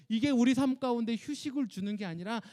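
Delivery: ordinary delivery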